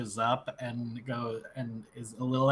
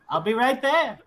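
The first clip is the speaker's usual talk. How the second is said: put-on old lady calling out; 12 semitones higher, 6 dB louder than usual. Granny calls out, hobbling behind.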